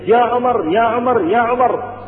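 calling out. Retold or imitated. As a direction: call him back across the courtyard. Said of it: "فإذا الغلام يصيح". (نداء مرتفع من بعيد)

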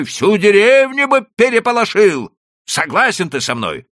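excited nobleman arguing his case; agitated landowner. A narrator's reading. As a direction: talk him round with rising heat. Chertopkhanov persuading Leiba.

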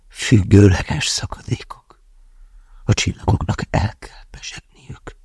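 slow breathy whisper, trailing away; barely voiced, exhausted murmur; sighed whisper. A narrator's reading. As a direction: let the words escape on a sigh. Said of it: "sóhajtotta".

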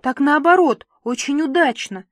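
eager chattering. A narrator's reading. Papa rushes to reassure about the dress, chattering eagerly. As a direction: rattle it out brightly, eagerly. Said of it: "затарахтел папенька".

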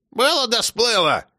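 high, slow snappy comeback; grandma brushing off her blood pressure; grandma retort. A narrator's reading. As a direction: wave the objection away.

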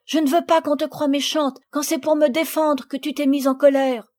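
ordinary delivery

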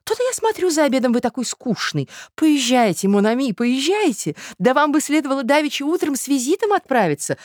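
high, loud, gushing matron; effusive society lady. Acted. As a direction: urge him off in a high, insistent voice.

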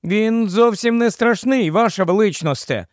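high, eager reassuring voice; hasty reassurance. A narrator's reading. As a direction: hurry to assure the queen. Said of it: "поспішив запевнити її Юстас".

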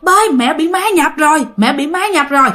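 high-pitched mock panic, easing toward the end; retold alarmed cry. Daughter tells father mother is possessed, crying out in alarm. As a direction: call out for help as a frightened child. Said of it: "chị của bạn mới la làng lên".

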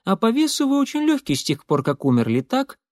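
high character voice, matter-of-fact query; Molly sizing up. A narrator's reading. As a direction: pitch it up, end on a questioning tag. Молли прикидывает вес рассказчика.

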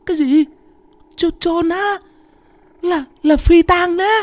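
very high, wailing stammer; terrified refusal. Acted. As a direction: whine in fright, begging out of it. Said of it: "Thằng Kiệt tái mặt". (giọng the thé, run sợ, lắp bắp)

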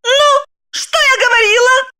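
high gloating "told you so"; triumphant. Acted. Wife crows she was right, high and triumphant. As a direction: crow over them. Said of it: "восторжествовала Загогулина".

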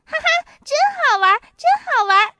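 very high, loud laughing glee; gleeful laughing. A narrator's reading. Boy laughing at the startled monkey, loud high glee. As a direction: cackle with delight.

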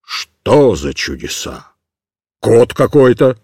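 very deep, slow baffled bark; astonished sergeant. A narrator's reading. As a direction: demand an answer. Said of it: "закричал он цыгану".

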